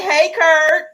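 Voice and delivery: bright, high, loud "Hey, Kurt" called out; cheerful greeting. Shown as a demonstration. Host showing the chat how to greet Kurt, high excited call. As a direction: sing out a big hello to the guest. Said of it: "those of you who are in the Rebirth Tribe, don't be rude".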